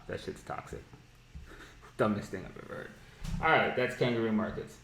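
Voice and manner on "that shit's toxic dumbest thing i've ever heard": soft, breathy aside of contempt; muttered disgust. Real, not acted. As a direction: mutter it under your breath. Disgusted aside about term.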